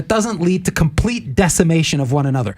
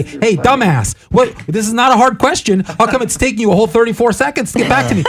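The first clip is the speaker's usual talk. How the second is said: higher, louder, exasperated put-on voice; mock impatient texter; 7 semitones higher, 4 dB louder than usual. Mocking an impatient texter nagging for a reply, hammed-up exasperation. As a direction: ham up the annoyed texter.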